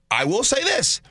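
usual speaking voice

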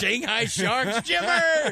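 high drawn-out fan cheer; mock cheer.

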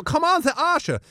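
high camp Austrian urging. Retold or imitated. Bruno pressing the wrestlers, high camp wheedling. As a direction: urge them in a high Austrian voice.

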